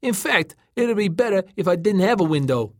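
calling down. A narrator's reading. Tom up in the Packard reasons with Joey, calling down. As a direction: call the afterthought down to him. Tom calls down from floating car.

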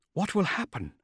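high, uneasy woman's voice; fear. A narrator's reading. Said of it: "the warmth of fear in her voice".

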